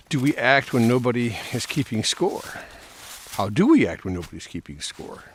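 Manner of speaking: earnest musing voice-over; reflective monologue. Acted. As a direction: ponder aloud, earnestly. Reflective monologue while mowing.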